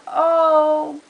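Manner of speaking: exasperated oh god. Retold or imitated. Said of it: "I'm just like".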